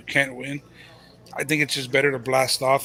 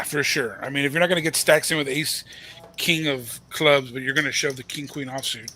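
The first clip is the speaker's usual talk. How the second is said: ordinary talk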